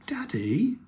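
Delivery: put-on high, airy child's call; mild sing-out to Dad. A narrator's reading. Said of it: "called Peppa over the noise".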